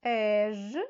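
"Еж" said clearly with a voiced zh; called-out syllable. Shown as a demonstration. Teacher calls a syllable for children to find, clear voiced zh. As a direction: sound out "Еж" for the class. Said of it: "Я буду називати склад".

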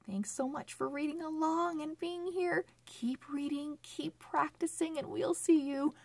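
high, fast upbeat farewell; cheerful sign-off. Acted. Host thanking children for reading along, cheerful and fast. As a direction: wrap up brightly for the kids.

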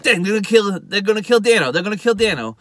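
high, loud, repeated worry; mock alarm. McGarrett fears they will kill Dano, alarmed. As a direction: play up the panic as you retell it.

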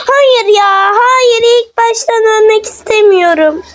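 panicked pleading, pitch rising; being chased. Real, not acted. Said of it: "Beni kovalıyor".

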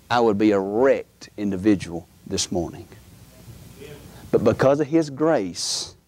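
low, measured preacher's testimony; reflective preaching. Real, not acted. Preacher reflecting to congregation on past grace, low and solemn. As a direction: drop low and let each phrase sit.

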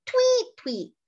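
first tweet very high, second dropped low; high then low, like a chickadee; whistled high note then low note; chickadee call. Shown as a demonstration. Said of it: "High then low".

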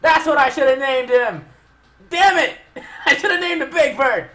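high, loud yelling broken by laughter; exasperated shouting. Real, not acted. Player regretting the Pokemon's name, exasperated shouting. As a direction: yell your regret, half laughing.

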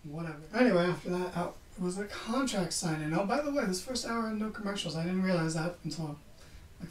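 normal talking voice

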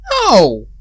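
loud, high no of horror; horrified shout. Real, not acted. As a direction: yell it out in shock. Horrified reaction.

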